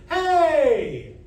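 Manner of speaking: high, loud held call. Shown as a demonstration. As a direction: call out with mostly chest. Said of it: "Call with your voice".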